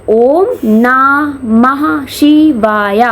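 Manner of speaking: very slow, separated syllables. Shown as a demonstration. Teacher breaking the mantra into seven syllables, slow and separated. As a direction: count out the mantra one syllable at a time. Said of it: "seven syllables हैं".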